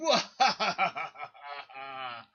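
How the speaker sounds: gleeful puppet villain cackle; villain laugh. Acted. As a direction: cackle like a cartoon bad guy. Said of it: "the bad guy starts laughing again".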